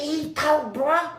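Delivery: high-pitched amazed report; retold astonishment. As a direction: tell the cellmate in excited disbelief.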